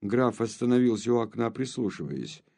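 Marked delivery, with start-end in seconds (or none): none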